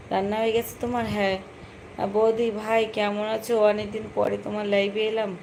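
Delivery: normal talking voice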